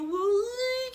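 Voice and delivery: high, loud pained cry of "ai"; a real cry of pain relived; retold scream. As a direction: let out a sharp scream of labor pain.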